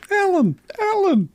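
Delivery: high, repeated call of the name. Pretend. Host calling out the actor's name at his co-host, high pitched. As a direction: yell the name up high, twice.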